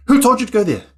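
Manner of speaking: high, loud scolding question; angry mum. Retold or imitated. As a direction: tear into the child as a cross mother. Angry mum scolds child in story.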